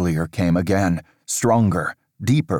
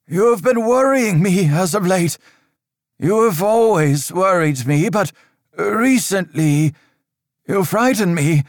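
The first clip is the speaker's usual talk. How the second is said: a higher, louder stern priest's voice, measured and grave; stern old priest; 13 semitones higher, 4 dB louder than usual. Acted. Father Riker warning Aldous of dark times, stern and raised. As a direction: lecture the boy on dark times like an anxious cleric.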